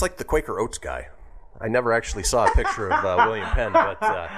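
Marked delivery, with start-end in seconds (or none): 1.6-4.3 higher, giggling through the words; laughing. Real, not acted. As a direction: crack up while talking. Laughing at Quaker Oats resemblance.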